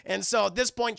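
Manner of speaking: ordinary delivery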